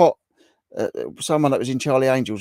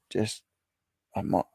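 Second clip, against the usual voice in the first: ordinary talk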